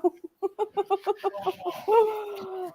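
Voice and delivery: long run of soft, high chuckles; laughing. Real, not acted. Watchalong hosts after Germany's goal, laughing. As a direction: laugh on and on, softly.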